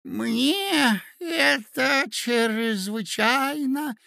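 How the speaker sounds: put-on very slow, high old man's voice; lisping prince. A narrator's reading. Flattered old prince answering the ladies, lisping. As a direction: lisp it, stretching every word in a high pitch. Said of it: "шепелявил князь".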